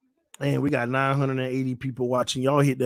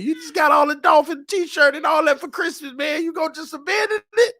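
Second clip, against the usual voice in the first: mock pleading; 13 semitones higher than usual. Real, not acted. Pleading with son.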